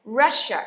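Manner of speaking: "Russia" loud with a curled-tongue sh; "Russia" projected, with a distinct sh; clear sh sound. Shown as a demonstration. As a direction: model the sh in "Russia" firmly. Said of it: "Nhớ là sh".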